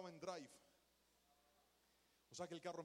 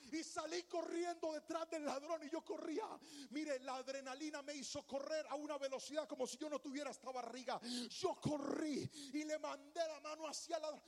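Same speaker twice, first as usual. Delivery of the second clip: higher, louder breathless storytelling with laughter; excited chase; 7 semitones higher, 5 dB louder than usual. Retold or imitated. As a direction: tell the chase like it's happening now. Retelling a chase.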